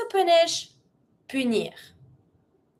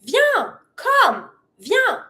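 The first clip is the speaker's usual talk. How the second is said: high-pitched call to an animal; super energetic command; 7 semitones higher, 3 dB louder, much slower than usual. Shown as a demonstration. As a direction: call the pet over with energy. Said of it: "We always try to be super energetic".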